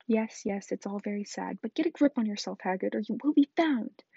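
ordinary delivery, nothing marked

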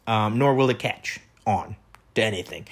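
normal talking voice

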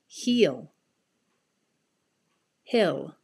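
long ee versus short ih in "Seat", "Sit", "Heel", "Hill", "Feel", "Fill", "Sleep", "Slip"; minimal pairs. Shown as a demonstration. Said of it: "listen again and repeat each word".

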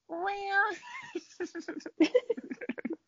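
high drawn-out meow; kitty meow. Pretend. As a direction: mew like a cute little kitten. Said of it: "A cute little kitty".

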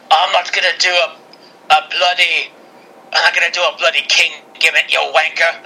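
badly faked Scottish accent, sliding toward British; Drew McIntyre. Podcaster acting Drew refusing the crown, bad Scottish accent. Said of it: "there's some Scottish way back in my family".

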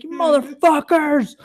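high, slow, furious cry; pissed-off yell. Retold or imitated. Angry cyclist curses the passing car, a high furious cry. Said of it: "Fucking pissed".

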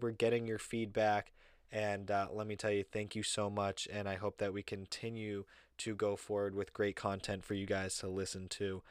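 usual speaking voice

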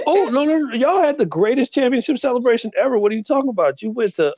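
high, loud protest in disbelief; excited disbelief. Real, not acted. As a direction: cry out no no in amazement. Excited disbelief at celebration story.

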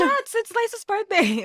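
high-pitched rallying announcement; mock excited cheer. Bar crowd celebrating her birthday, excited. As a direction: imitate a crowd getting hyped.

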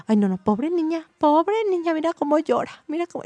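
high, exaggerated cooing pity; pitying onlookers, mocked. Strangers in the queue judging mom, mock high-pitched pity. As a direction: imitate busybodies lamenting over a poor child. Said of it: "empezaron a hablar mal de mí".

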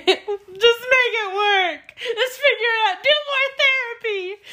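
hammed-up tearful begging, far up in pitch; mock crying wail. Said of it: "like, crying".

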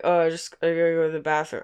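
quick mumbled excuse; hurried, indistinct mock muttering; imitated mumble. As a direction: run the words together, imitating his mumble. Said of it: "like, mumble".

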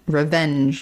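usual speaking voice

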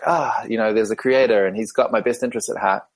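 ordinary delivery

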